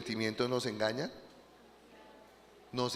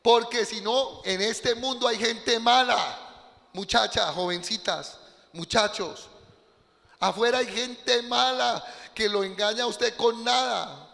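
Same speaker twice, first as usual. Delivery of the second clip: preaching; 7 semitones higher, 3 dB louder than usual. Acted. Warning the congregation.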